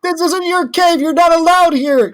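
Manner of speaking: high-pitched, insistent scolding; indignant yelling. Acted. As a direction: order them out in a high, worked-up voice. Screaming match with kobolds.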